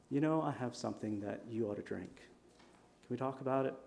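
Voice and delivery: soft, quiet offer; gentle invitation. Acted. Jesus offering the woman at the well a drink, softly. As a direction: coax her gently, no pressure.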